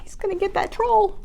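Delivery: usual speaking voice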